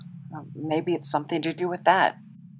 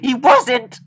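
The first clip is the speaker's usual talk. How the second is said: crying, loud and higher; sobbing shout; 7 semitones higher, 6 dB louder than usual. Acted. To the doctor who let her friend die: sobbing shout. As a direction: sob out the accusation. Grief-stricken accusing the doctor.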